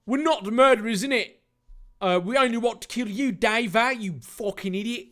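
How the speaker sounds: high, put-on voice; indignant Jack character. Jack denying murder to Dave, indignant.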